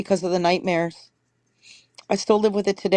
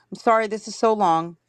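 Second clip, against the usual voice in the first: ordinary talk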